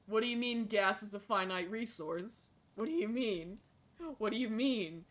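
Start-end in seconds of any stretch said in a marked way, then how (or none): none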